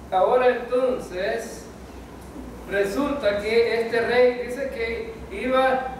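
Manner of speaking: slow, drawn-out preaching; slow resumption. Real, not acted. Preacher takes the congregation back to the king's story, slowly. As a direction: stretch each word as you return to the story.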